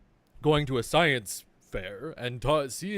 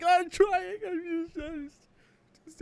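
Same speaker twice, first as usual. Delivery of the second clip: high, strained whining; flustered whine; 3 dB louder, much slower than usual. Retold or imitated. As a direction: squeak out a helpless excuse. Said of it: "I'm like".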